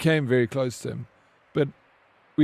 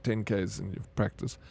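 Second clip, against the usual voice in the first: ordinary delivery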